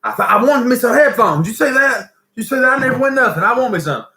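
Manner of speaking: high-pitched, excited retelling of a happy winner; overjoyed winner. Retold or imitated. Friend jumping up and down over winning headphones, overjoyed. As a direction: crow it high, like you can't believe your luck. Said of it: "He was the happiest fellow you've ever seen".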